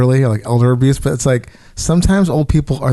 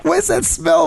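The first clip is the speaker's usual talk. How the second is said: puzzled wife. Retold or imitated. Wife voiced in story.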